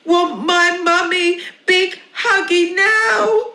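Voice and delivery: put-on high loud toddler wail; screaming baby. Baby screams for a hug from mummy.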